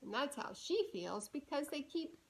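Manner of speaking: quiet, sleepy-sounding question; soft aside. Shown as a demonstration. Reader asking the child about being tired. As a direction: ask gently, like at bedtime.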